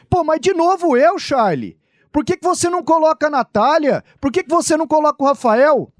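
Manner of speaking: high, whiny pleading; whiny complaint. Acted. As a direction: complain like you always get stuck. Acted complaining reporter skit.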